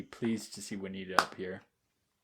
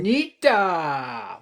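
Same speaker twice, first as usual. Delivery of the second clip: long drawn-out shout of a name; calling out; 6 semitones higher, 14 dB louder, much slower than usual. Real, not acted. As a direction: call her name loud and slow. Calling out to a friend.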